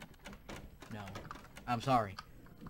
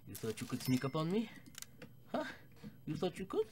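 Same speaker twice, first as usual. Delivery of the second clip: mocking taunt; 6 semitones higher than usual. Taunting a sneaking enemy.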